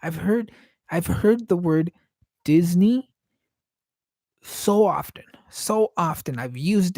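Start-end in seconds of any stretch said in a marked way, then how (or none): 2.4-3.1 Disney said "DIZ-nee", higher and louder; Disney ending like "knee"; corrected pronunciation. Shown as a demonstration. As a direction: end Disney on "nee", emphatically. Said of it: "I force myself to say it the way it's supposed to be said".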